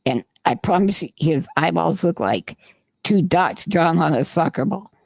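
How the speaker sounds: usual speaking voice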